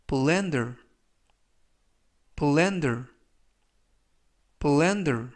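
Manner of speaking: blender read clearly with a falling close; model pronunciation. Shown as a demonstration. Teacher modelling the word for students, careful pronunciation. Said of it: "이라고 발음하시면 되겠고요".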